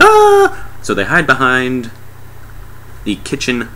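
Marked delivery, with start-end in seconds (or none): 0.0-0.7 very high, loud squeal; mock frightened scream. Group sees the tentacle, mock scream. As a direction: squeal in comic terror. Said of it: "they're all like".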